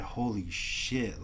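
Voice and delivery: slow, drawn-out soft holy shit; drawn-out quiet awe; slow amazement. Retold or imitated. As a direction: let it sink in slowly. Reacting to getting internship.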